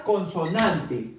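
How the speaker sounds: «consonante» lento y grave tras «Vocal»; slow contrast word. Shown as a demonstration. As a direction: alarga despacio «consonante». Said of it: "cuando hablamos de consonantes". («consonante» dicho despacio, cayendo)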